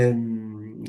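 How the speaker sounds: ordinary delivery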